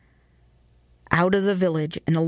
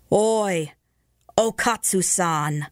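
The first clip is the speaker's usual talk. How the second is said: warning call; slower than usual. A narrator's reading. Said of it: "called a warning voice".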